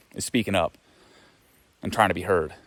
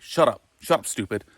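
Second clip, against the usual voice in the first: mock angry snap; 2 semitones higher, 4 dB louder than usual.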